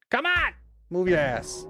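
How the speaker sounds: normal talking voice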